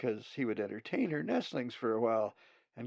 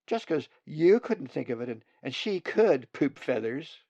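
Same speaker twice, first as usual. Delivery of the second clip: higher, louder sibling retort; indignant nestling; 4 semitones higher, 9 dB louder than usual. Acted. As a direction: stick up for your sister with a childish insult. Sibling defending Zolly, insulting.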